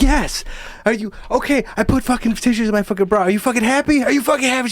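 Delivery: high-pitched imitation of a girl breaking down; upset outburst. Teased girl confronting her bully, upset and fed up. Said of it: "she broke down".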